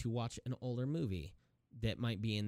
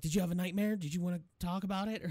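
caring stepdad; 8 semitones higher, faster than usual. Retold or imitated. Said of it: "he's like".

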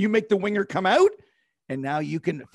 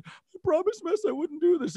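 choked up; 11 semitones higher than usual. Retold or imitated. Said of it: "when I started getting emotional".

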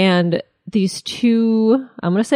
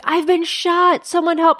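mock screaming; 9 semitones higher than usual. Retelling the wounded dog walker's plea, mock screaming. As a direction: scream it at a conversational volume, pitched way up. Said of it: "like, screaming".

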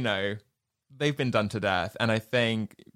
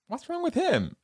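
Potato Head. Retold or imitated. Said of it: "Mister Potato Head goes".

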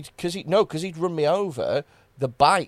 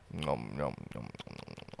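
low soft chewing sounds; munch it softly, teasing; eating noises; 16 dB quieter than usual. Pretend. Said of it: "me making that sound".

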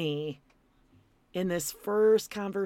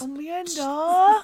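excited call; 10 semitones higher, 3 dB louder, much slower than usual. Real, not acted. Calling out in delight.

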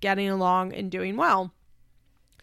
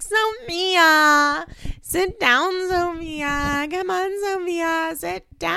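pleading mom; 9 semitones higher, 5 dB louder, much slower than usual. Retold or imitated. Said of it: "Farrah's like".

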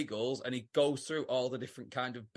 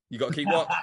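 ordinary talk

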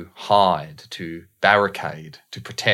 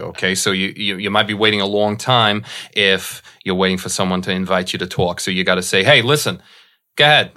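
pushy New York accent, louder as the quote comes; 4 dB louder than usual. Shown as a demonstration. Asked by the host, the coach shows off a New York accent. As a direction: squeeze in like a New Yorker who won't wait his turn. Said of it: "Can you please do a little bit of a New York accent?".

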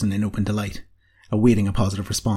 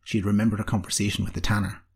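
ordinary delivery, nothing marked

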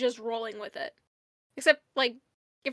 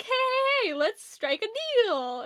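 slimy salesman pitch, high drawn-out hey; oily car salesman opening a deal; Swindle; 8 semitones higher, slower than usual. Acted. Swindle immediately pitching Ultra Magnus a deal, slimy salesman. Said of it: "For a slimy cars salesman".

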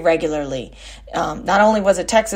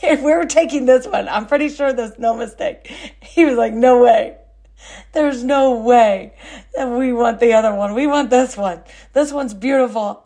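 imitating dad, much higher and louder, laughing through it; laughing, pitched-up mock dad; gleeful dad; 6 semitones higher, 4 dB louder than usual. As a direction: play the delighted dad refusing to give her back. Dad refusing to swap dogs.

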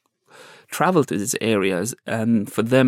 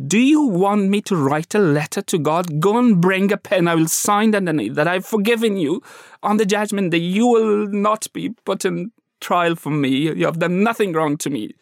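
mild mock shout; 7 semitones higher than usual. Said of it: "I shouted".

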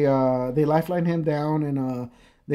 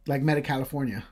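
usual speaking voice

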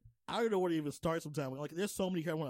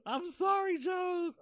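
shouted apology; 11 semitones higher, slower than usual. Real, not acted. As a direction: yell the apology up high. Calling out apology to character.

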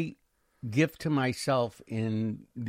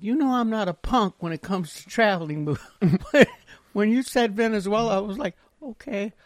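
laughing sister; 8 semitones higher than usual. Retold or imitated. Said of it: "she said".

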